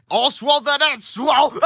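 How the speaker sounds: high, slow villain voice into a loud laugh; gloating villain. Acted. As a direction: cackle at your own pun.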